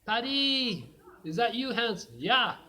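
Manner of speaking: loud, high shouted exchange across the trenches; high, loud calls between enemy lines; calling out. Acted. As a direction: yell the question over and shout back the answer. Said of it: "and he called out".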